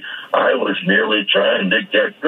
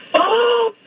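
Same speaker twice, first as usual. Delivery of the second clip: dazed tripper. Retold or imitated. Voicing the tripping patient.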